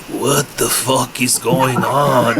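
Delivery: put-on slow, drawn-out Canadian accent, shocked. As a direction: do Gord waking up in alarm, Canadian accent. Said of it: "That's a pretty good Canadian accent".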